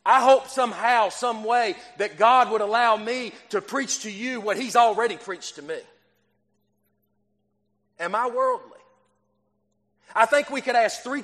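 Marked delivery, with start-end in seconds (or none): none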